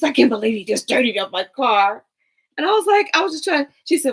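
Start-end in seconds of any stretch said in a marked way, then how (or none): 0.0-2.0 louder mock cousin scolding. Cousin scolding me for touching her window, loud and annoyed. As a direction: snap it like the fussy cousin.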